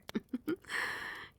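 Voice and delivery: short, airy giggle; soft chuckle. Acted. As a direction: chuckle fondly at a pet. Queen pleased with her pet.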